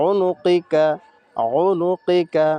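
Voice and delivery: উনুকিকা spoken slowly and deliberately; slow recitation. Shown as a demonstration. Teacher repeating উনুকিকা for students, slow and deliberate. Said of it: "এভাবে আমরা প্রত্যেকটা".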